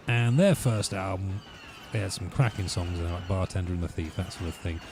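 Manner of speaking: ordinary talk, nothing marked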